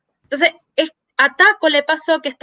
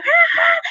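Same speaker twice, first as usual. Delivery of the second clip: retold scream. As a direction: imitate a panicked shriek lightly. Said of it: "todas las mujeres". (grito agudo imitado, sin volumen)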